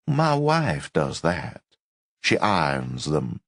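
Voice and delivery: slow, gentle Southern drawl; lightly voiced smug Southerner; soft Southern accent. A narrator's reading. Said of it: "soft Southern accent".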